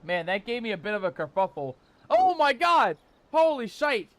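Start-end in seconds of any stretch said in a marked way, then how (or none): 2.1-4.1 loud, higher cry of shock; startled shout. Real, not acted. Streamer caught off guard by Sarah, shouting loud and high. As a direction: yelp it out in real surprise.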